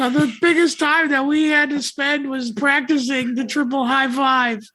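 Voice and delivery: put-on high, earnest old-timey kid; wholesome 1950s kid. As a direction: play a gee-whiz wholesome boy. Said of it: "Why is he talking like that?".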